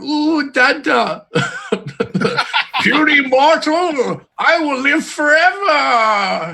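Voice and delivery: put-on very slow, high, childish boast; a newborn deity gloating; baby god voice. Acting newborn Titan talking to its maker.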